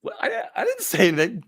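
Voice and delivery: high, fast, stammering put-on voice; squeaky mock defensiveness; bewildered opponent. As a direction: imitate a flustered guy protesting innocence. Startled opponent's reply, acted out.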